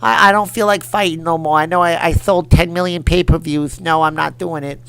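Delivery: high, loud lisping boxer; Mike Tyson. Acted. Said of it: "Mike Tyson will be like".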